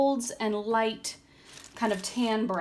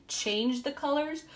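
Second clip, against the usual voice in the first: yawning; 4 semitones higher than usual. Real, not acted. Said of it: "I'm yawning".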